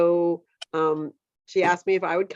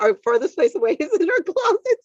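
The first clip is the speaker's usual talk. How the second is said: laughing; 11 semitones higher, 5 dB louder than usual. Retold or imitated. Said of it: "She's like".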